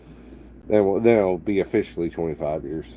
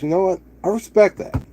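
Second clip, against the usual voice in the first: lifted, much higher voice for the stranger's approval; impressed bar stranger; 9 semitones higher than usual. Retold or imitated. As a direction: quote the man warmly impressed, pitched way up. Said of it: "He goes".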